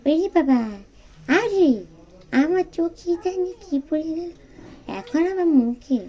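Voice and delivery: put-on high, loud child yelping at cold water; in ice cold water. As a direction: squeal like a baby in cold bathwater. Gopal bathed in cold water.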